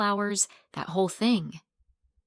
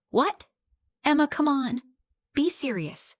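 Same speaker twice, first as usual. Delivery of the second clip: higher-pitched, incredulous protest; a mildly disbelieving plea, pitched up; taken aback, voice lifted; 7 semitones higher than usual. A narrator's reading. Disbelief at friend's demand.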